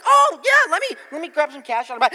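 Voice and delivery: sarcastic offer to pay in cash; hammed-up sarcasm. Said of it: "I'm like".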